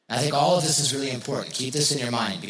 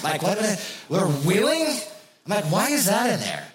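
higher, incredulous questioning; playful bafflement; 6 semitones higher than usual. Acted. Preacher questioning the Bible's odd wording, baffled. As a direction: puzzle aloud at an absurd detail.

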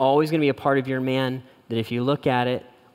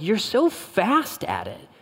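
impressed wife; 6 semitones higher, slower than usual. Retold or imitated. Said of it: "She's like".

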